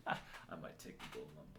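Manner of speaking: usual speaking voice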